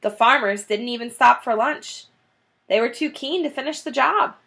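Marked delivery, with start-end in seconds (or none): none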